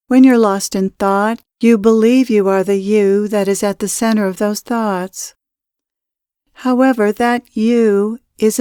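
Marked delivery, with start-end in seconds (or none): none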